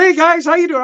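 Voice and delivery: forced breezy greeting pitched high to hide the pain; fake casual cheer. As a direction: act totally fine while hurting, voice pushed up. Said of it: "I was talking like this".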